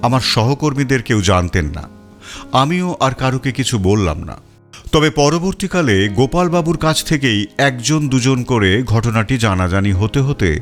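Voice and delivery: plain reading voice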